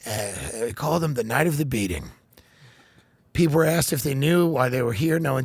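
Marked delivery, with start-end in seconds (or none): 0.1-2.0 much lower, softer, calm statement; mock Biden calmly announcing the beatings. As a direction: say it very calmly, like a president. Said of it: "Biden says it very calmly".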